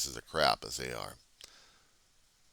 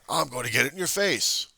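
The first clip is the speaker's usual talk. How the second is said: mock heckler; 9 semitones higher, 6 dB louder than usual.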